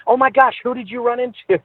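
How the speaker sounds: retold naive gasp of curiosity; mock surprise. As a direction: play it dumb and eager. Mock curious reply to fan.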